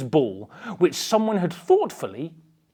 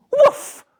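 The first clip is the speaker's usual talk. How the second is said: dog bark; 7 dB louder than usual. Acted. Dog barks in story.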